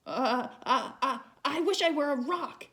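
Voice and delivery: high, loud stammering panic; panicked. Acted. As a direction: stammer out a frightened wish. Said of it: "he panicked".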